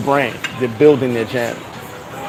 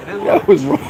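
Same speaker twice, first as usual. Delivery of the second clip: breathless laugh; 12 semitones higher, slower than usual. Real, not acted. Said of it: "That was, that was wrong".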